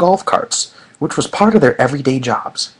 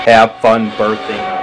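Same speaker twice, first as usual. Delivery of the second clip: teasing low voice, slower; 4 semitones lower, 4 dB louder, slower than usual. Acted. Repeated teasing.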